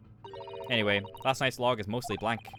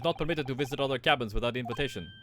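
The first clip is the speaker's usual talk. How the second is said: put-on proud guard in a Russian accent. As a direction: boast about first class in a Russian accent.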